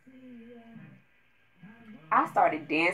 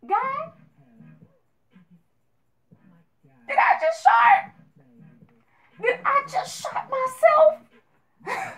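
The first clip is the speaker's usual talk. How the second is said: high, loud shrieking prayer, the last line slow; panicked prayer; 6 dB louder, slower than usual. Retold or imitated. Praying to God mid-walk, high panicked shriek. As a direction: beg God in rising panic. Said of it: "I'm praying to God like".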